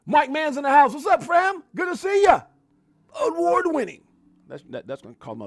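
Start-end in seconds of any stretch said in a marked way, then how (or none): 0.1-4.0 high, loud hype shouting; throat-straining hype shout. Shown as a demonstration. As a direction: shout them in like a hype man. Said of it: "I'm gonna lose my damn voice".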